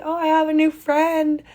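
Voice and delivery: mock little kid. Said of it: "be like".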